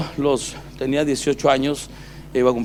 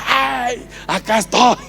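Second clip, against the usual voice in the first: calling out; 11 semitones higher, 3 dB louder, slower than usual. Retold or imitated. Calling down to mom from above.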